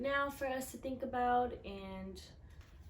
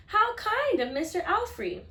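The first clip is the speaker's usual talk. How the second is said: higher, louder admiring grandma; pleased grandma; 12 semitones higher, 11 dB louder than usual. Acted. Grandma praising the counselor's gift, lightly voiced pleased grandma. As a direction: sound touched by the kindness.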